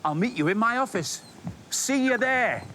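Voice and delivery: ordinary delivery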